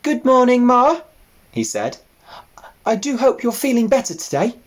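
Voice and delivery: high eager little pig; piglet voice. Acted. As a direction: ask it like a keen young piglet.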